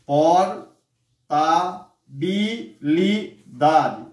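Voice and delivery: Portabilidade slowly split por-ta-bi-li-da-de; syllable by syllable. Shown as a demonstration. Teacher to class, counting the syllables of Portabilidade, very slow. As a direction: sound out all six beats of Portabilidade. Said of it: "palavra que tem seis sílabas".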